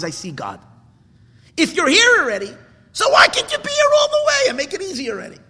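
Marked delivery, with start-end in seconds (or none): 1.6-5.4 much higher, loud anguished cry; desperate shout. Real, not acted. Preacher demanding of God why it can't be easy, shouting in anguish. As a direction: shout it at heaven, begging. Crying out to God mid-sermon.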